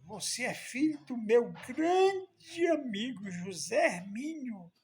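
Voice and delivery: drawn out, stretching grande with emotion; a moved old man recognising his friend's son; moved exclamation. Retold or imitated. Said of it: "olhou bem pra mim e disse".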